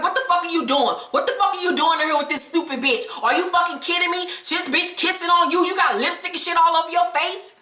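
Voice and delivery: mock angry confrontation at the door; mock jealous rage. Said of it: "she's like".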